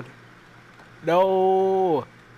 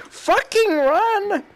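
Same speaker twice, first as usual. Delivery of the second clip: panicked yell; 5 dB louder, much slower than usual. Real, not acted. Fleeing from mobs.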